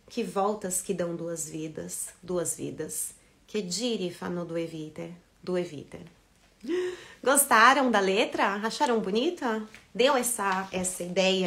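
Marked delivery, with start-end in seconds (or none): 0.1-6.0 soft lyric recital. Acted. Teacher ending the song lyrics for the students, low and soft. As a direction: lower and softer, recited like the end of a song.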